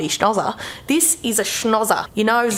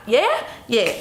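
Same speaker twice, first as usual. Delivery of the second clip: ordinary delivery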